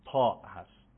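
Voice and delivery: deep, heavy emphatic ط; emphatic Arabic ta sound. Shown as a demonstration. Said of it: "این حرف".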